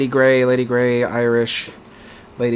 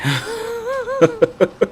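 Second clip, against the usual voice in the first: a sudden squeal of laughter, far higher. Real, not acted. Laughing at the tied tea vote, squealing.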